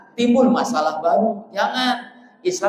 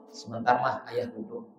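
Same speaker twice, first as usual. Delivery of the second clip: calm husband; 5 semitones lower, 8 dB quieter than usual. Retold or imitated. Calm husband answers nagging wife.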